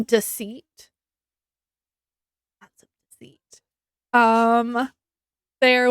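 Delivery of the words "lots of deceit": breathy whisper, nearly silent; a secret whispered to the viewer; whispered aside. Acted. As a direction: drop to a whisper as a sly aside. Whispered aside about the book.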